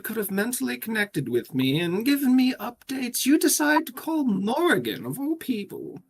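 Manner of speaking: high, exasperated telling-off; indignant scolding. Acted. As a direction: take them to task in a high voice. Charity scolds the player's character.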